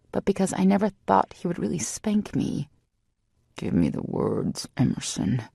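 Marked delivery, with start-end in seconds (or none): 3.6-5.4 deep male command. A narrator's reading. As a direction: drag it out low like a growling order. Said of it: "I hardly recognize his voice".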